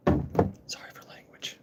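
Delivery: hushed apology in a whisper. Real, not acted. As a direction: breathe the apology out. Whispered aside after swearing.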